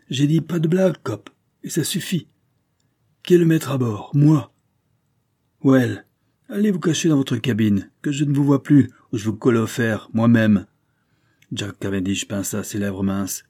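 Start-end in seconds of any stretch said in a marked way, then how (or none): 0.1-10.7 higher and louder, barking orders; angry captain. Acted. Captain threatening the detective with irons, angry and loud. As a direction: put him in his place. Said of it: "captain angrily orders detective away".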